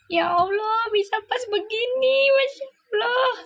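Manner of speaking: amazed high cry. Real, not acted. Amazed by matching cards.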